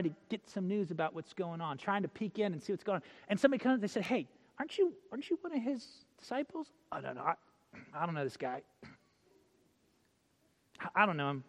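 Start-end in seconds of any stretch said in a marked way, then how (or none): 4.0-6.7 higher, questioning bystander voice; lightly acted accusing question; curious bystander. 6.9-11.4 low, soft brush-off; acted shifty, subdued denial; evasive denial. Acted Peter denying he knows Jesus.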